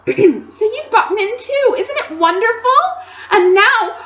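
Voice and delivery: bubbling with delight; excited Lucy. Acted. Lucy greeting Edmund, thrilled and high.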